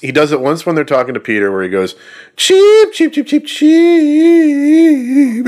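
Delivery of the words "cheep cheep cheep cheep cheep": high squeaky chicken cheeps, dragged out. Retold or imitated. Mocking the actor's chicken taunt, high drawn-out cheeps. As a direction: cheep like a chicken, stretching the last one long. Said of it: "And he, like, drags it out".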